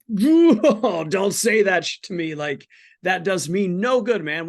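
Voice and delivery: high, loud, fed-up protest; exasperated outburst. Retold or imitated. To friends saying pray about it, an exasperated outburst. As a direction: blow up at a friend's useless advice. Retelling his angry reaction to friends.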